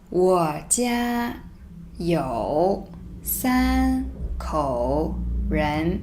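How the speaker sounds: very slow, separated words; reading slowly word by word. Shown as a demonstration. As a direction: spell it out word by word. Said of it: "let me read it first".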